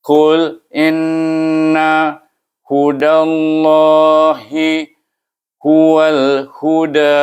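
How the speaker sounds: halting, separated syllables. Shown as a demonstration. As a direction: spell out Qul inna hudallahi huwal huda by syllables. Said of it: "kita bersebut suku kata, mengikut suku kata".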